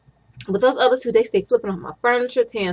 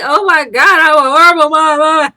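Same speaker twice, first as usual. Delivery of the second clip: loud, higher half-laughing mock cry; mock wail; 7 semitones higher, 12 dB louder than usual. Mom mocking her own guilt to viewers, loud laughing wail. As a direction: ham up a guilty mother's lament. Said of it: "And I'm like".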